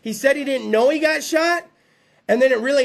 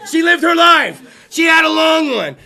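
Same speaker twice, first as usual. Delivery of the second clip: mock insistence; 4 semitones higher, 7 dB louder than usual. Mock-defends letting mom die.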